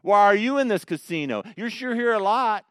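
loud, high, needling challenge; mock-indignant accuser, loud and high; accusing unbeliever. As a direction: call out a churchgoer caught in a bar. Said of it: "The unbeliever always knows how we should act".

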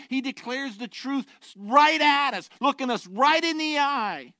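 loud, high pulpit proclamation, slow on key words; full-force preaching. Acted. Pastor declaring heaven and hell to his church, loud preaching. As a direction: thunder the two worlds at the people.

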